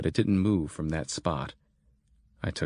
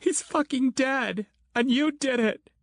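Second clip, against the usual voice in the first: grieving Christine. Acted. Christine accuses Grant of Julio's death, high and anguished. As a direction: blame him through the grief.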